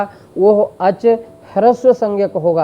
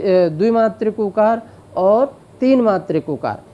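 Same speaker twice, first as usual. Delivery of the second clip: ordinary talk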